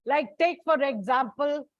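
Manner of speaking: normal talking voice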